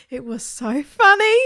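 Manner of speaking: merry squeal; laughing. Acted. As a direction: still caught in giggles. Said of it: "couldn't stop laughing".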